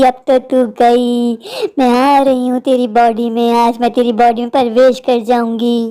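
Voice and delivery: deep, cold vengeful spirit; low and merciless, a ghost taking a body. Acted. Ghost refuses mercy, possesses girl. (गहरी बेरहम आत्मा की आवाज़)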